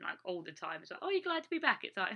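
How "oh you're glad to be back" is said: high, breezy imitation of a nosy asker; mock cheery question. Stranger asking the returning expat, in a high mocking chirp. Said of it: "when you get asked that question".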